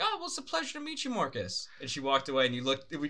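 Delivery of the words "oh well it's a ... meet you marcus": put-on high, quick polite girl voice; imitating Welsh girl. Friend imitating the girl who mispronounced Marcos, high and quick. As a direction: rattle it off sweetly, high. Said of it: "She was like".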